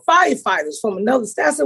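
normal talking voice

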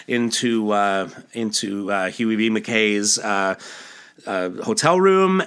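usual speaking voice

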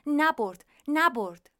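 high, loud parroting bird voice; loud squawked repetition from the cage; starling repeating. Acted. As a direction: echo the word like a talking bird. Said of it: "خانمچه تکرار کرد".

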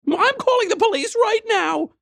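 put-on young woman screaming. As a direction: shriek the threat like a terrified woman.

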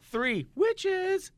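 high excited hype. Acted. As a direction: squeal it like big news. Hyping up Hopo three.

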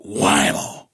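drawn-out, booming "whammo"; mock crash exclamation. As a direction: punch it out as the impact hits. Voices the crash impact.

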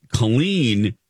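ordinary delivery

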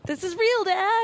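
put-on high, insistent kid voice; excited kid. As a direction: insist to dad it's real.

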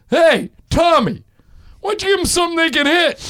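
high, loud mock heckle from the stands; fan heckling. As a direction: call out to the pitcher from the bleachers.

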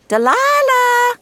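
long, loud, high call across the room; waitress calling a coworker. Acted. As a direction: yell her name across the diner.

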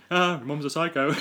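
lightly imitated film character's accent; mock Kiwi accent, livelier at the end; put-on accent. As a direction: quote it in a put-on New Zealand accent. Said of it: "I like your accent".

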